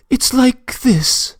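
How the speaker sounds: a trembling start, barely holding on; weak quivering voice. Acted. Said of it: "in a weak and quivering voice".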